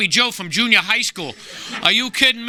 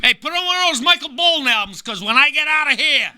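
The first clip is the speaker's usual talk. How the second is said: cheesy lover call; 7 semitones higher than usual. Retold or imitated. Guy on toilet calls to wife.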